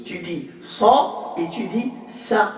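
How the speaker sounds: sa heavy then sa light, slow; sad versus sin. Shown as a demonstration. Teacher contrasts sad and sin for students, slowly. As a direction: say sa emphatic then sa thin, slowly. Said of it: "on a sad et on a sin".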